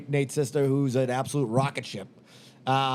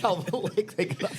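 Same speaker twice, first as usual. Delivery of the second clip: laughing echo; 4 semitones higher than usual. Real, not acted. Repeating joke laughing.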